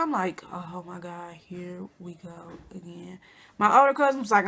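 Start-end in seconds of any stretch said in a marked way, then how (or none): 0.5-3.2 weary eye-roll. Retold or imitated. Rolling her eyes at the invitation.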